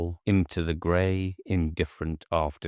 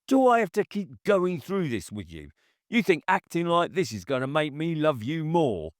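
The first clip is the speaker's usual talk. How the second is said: higher, angry scolding; bullying boyfriend's angry tirade; rising anger; 11 semitones higher, faster than usual. A narrator's reading. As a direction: berate her with rising anger. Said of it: "his voice rising sharp with anger".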